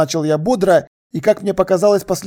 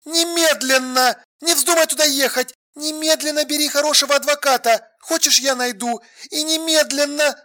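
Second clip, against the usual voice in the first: high, urgent orders without volume; urgent woman on the phone; 8 semitones higher, 3 dB quieter than usual. A narrator's reading. Alla urging Sasha to get a lawyer, urgent. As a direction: order him urgently in a higher voice.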